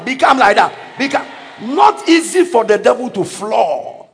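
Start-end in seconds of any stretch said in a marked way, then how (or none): none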